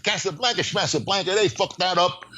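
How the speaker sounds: put-on griping old Brooklyn writer; Brooklyn accent. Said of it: "he never lost that Brooklyn accent".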